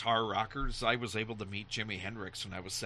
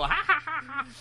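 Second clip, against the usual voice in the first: high, loud imitated child's laugh; mocking kid laugh; 7 dB louder than usual. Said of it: "I started laughing at this old janitor".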